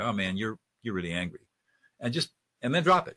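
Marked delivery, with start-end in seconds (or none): 0.0-1.5 low, soft, informal one-liner; throwaway line. Shown as a demonstration. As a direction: drop it casually, conversationally. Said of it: "It's just a throwaway line".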